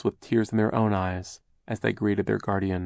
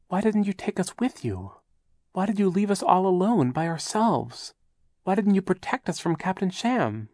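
hurt reproach; 10 semitones higher than usual. A narrator's reading. Klaus reproachful questions.

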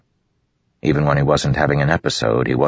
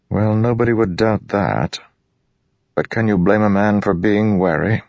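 higher-pitched, guarded nobleman; wary high prince; 7 semitones higher than usual. Acted. Royan explains his wariness to Dalinar, guarded.

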